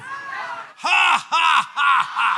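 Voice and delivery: loud, high "ha, ha, ha, ha"; defiant laugh. Shown as a demonstration. Preacher laughing at the devil before the church, defiant laugh. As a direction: laugh in the devil's face, beat by beat. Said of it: "I'm laughing at the devil".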